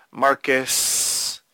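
ordinary talk, nothing marked

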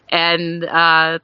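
ordinary talk, nothing marked

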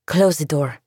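curt order with a mild edge; a touch of an angry snap; snapped. Acted. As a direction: cut him off sharply. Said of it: "she snapped".

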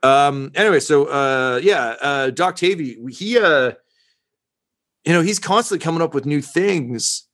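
chatty newsman, a bit nervous; reporter voice. Acted.

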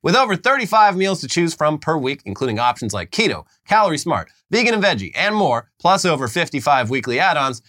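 upbeat sponsor read. Acted. Host reads the Factor sponsor spot, upbeat ad read. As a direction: sell it like a podcast ad.

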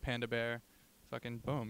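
ordinary talk

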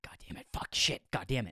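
hushed whispered swearing under the breath; muttering curses in dread at the talkative plane neighbour; whispered cursing. Retold or imitated. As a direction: whisper the curses so he can't hear. Dreading a chatty seatmate on plane.

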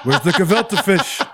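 put-on high, laughing Yiddish accent.

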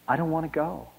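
put-on mild, high soft child's plea; understated childlike protest; screaming through sobs. A narrator's reading. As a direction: voice the sobbing boy softly, high and small. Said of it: "tried to scream through his sobs".

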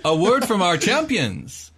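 high, loud ceremonial announcement; booming prize announcer. Acted. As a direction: announce it to the whole arena. Announcer hands over the mic.

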